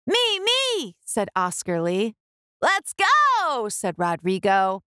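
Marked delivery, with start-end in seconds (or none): 0.1-0.9 high, excited me me; boy eager to join the fort. Acted. Boys eager to play. 2.6-3.7 high, excited me me; boy eager to join the fort. Acted. Boys eager to play.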